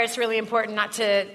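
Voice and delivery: ordinary talk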